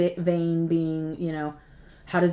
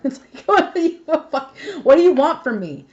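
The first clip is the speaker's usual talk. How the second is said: laughing protest; 7 semitones higher, 6 dB louder than usual. Real, not acted. Laughing at imagined viewer complaint.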